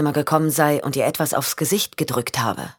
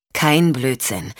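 "Kein Blödsinn." said slowly and evenly, weight on "Kein"; slow down and lean on "Kein" to settle her; "Kein Blödsinn." measured and slow, stress on "Kein"; calm rebuttal; 3 semitones lower, slower than usual. Acted. Sean calmly counters Michelle.

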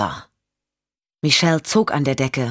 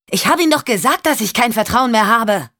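much higher, clipped reproach; Michelle, fed up; 6 semitones higher, 3 dB louder than usual. Acted. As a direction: remind him sharply. Michelle rejects him again.

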